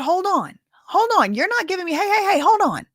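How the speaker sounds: high, loud hold on hold on; mock protest. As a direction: cut her off like an indignant witness. Acted witness cutting off lawyer.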